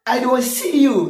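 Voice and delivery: ordinary talk, nothing marked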